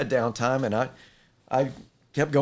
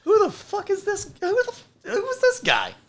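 much higher, squeaky mock outrage; incredulous inner voice. Young inventor brushed off by the chief, squeaky mock outrage. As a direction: play your own baffled thoughts, way up high. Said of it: "I was like".

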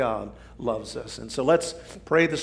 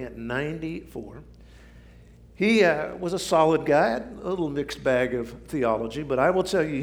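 ordinary talk, nothing marked

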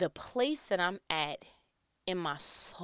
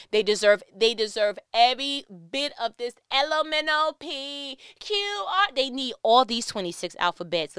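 spiteful mock rant; 2 semitones higher, 6 dB louder than usual. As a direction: voice a grudge-holder going all out. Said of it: "you may have something in your heart that you like".

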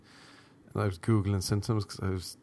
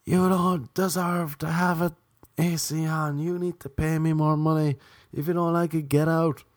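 mock scream; 5 semitones higher than usual. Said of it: "she came screaming out the door".

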